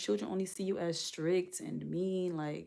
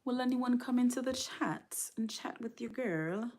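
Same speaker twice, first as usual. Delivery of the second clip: higher-pitched mock British accent; bad British accent; 6 semitones higher, 3 dB louder than usual. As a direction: put on a shaky attempt at British English. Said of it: "my British accent is just not good".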